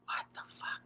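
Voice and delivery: breathless stunned whisper under the reading; whispered aside. Real, not acted. Reader stunned by his account, whispering in disbelief. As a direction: drop to a shocked whisper, almost to yourself.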